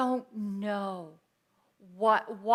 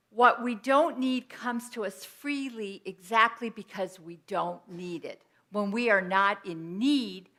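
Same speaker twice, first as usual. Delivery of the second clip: ordinary talk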